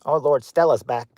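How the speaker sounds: ordinary delivery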